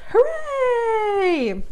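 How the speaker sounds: very high, long drawn-out hooray; high-pitched joyful cheer; celebrating. Real, not acted. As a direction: squeal a little cheer, stretched out. Celebrating a zero balance.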